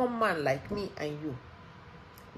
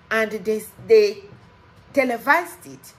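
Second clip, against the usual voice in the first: outraged shout; 4 semitones higher, 11 dB louder than usual. Real, not acted. Outraged at televised ruling.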